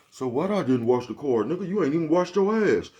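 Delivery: high, loud defensive snap back; defensive outburst. Retold or imitated. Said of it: "so you get real defensive".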